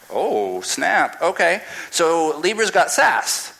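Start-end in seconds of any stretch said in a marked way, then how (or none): none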